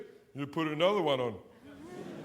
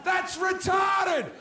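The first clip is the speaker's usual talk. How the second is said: high, loud, wailing shout of self-pity; hammed-up anguished yelling; despairing rant; 9 semitones higher, 3 dB louder than usual. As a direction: rant it out like a man at the end of his rope. Mock-despairing comic rant.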